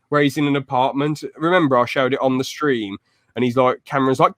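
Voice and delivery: ordinary delivery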